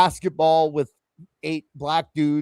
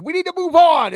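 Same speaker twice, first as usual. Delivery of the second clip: urgent interruption; 12 semitones higher, 7 dB louder than usual. Retold or imitated. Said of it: "one of us is like".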